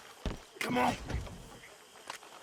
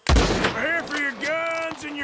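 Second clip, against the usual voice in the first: shouting; 9 semitones higher, 6 dB louder than usual. Acted. Robbery shootout erupts.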